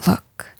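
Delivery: long breathy sigh then a whispered Look; exasperated sigh. Acted. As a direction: let it all out before calming down. Boss calms himself down.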